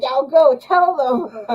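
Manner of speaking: high laughing cheer, slow and amused. Retold or imitated. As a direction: cheer them on through laughter. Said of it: "I was like".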